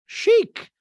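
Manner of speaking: piercing, loud falsetto caricature; Dorit. Retold or imitated. Recapper mimicking a castmate accepting an apology, loud falsetto. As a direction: stretch it out high and loud. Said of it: "And Dorit's like".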